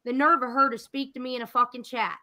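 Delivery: normal talking voice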